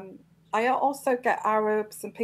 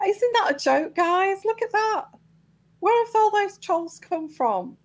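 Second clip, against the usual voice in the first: much higher, incredulous outburst; exasperated disbelief; 10 semitones higher than usual. Real, not acted. Host to the trolls flooding her chat, pitched-up disbelief. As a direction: throw up your hands at the trolls.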